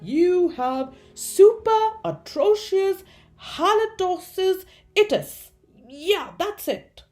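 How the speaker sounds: loud, high pronouncement; emphatic comic proclamation; grand diagnosis. A narrator's reading. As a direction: announce it with pompous weight. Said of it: "announced his diagnosis".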